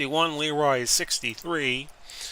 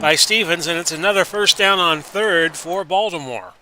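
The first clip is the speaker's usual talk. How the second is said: louder, quicker sports call as the play unfolds; 3 semitones higher, 6 dB louder than usual. Acted. Announcer to viewers, live play-by-play.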